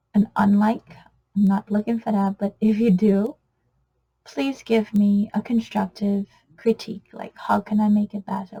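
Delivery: normal talking voice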